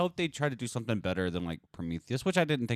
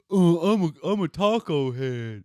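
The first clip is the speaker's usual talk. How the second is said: high, loud mocking brag; mocking truck bro; 8 semitones higher, 7 dB louder, slower than usual. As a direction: sneer at the proud fanboy. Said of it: "culture of like".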